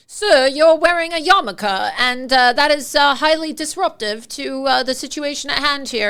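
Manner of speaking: put-on British accent, officious policeman; mock British accent. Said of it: "in his accent, right".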